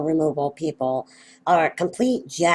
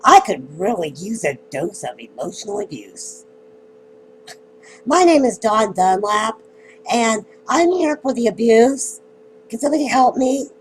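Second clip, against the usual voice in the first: sarcastic mock introduction; 5 semitones higher, 5 dB louder than usual. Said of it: "either that or the".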